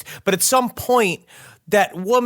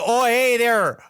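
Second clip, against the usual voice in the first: higher-pitched, botched Australian greeting; bad Australian accent; 6 semitones higher, slower than usual. Comic posing as the ghost at her door, bad Australian accent. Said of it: "Australian accent, being like".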